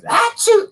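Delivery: fake sneeze. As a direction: act out a big sneeze. Acts out sneeze again.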